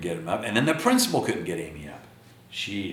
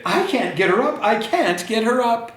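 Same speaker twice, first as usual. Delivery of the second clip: exasperated mother; 13 semitones higher, 4 dB louder than usual. Acted. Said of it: "ran back downstairs and said".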